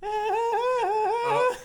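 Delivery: very high, loud eerie wail; high horror sound effect. Pretend. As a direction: shriek like the It effect. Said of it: "imitate the classic sound effect from It".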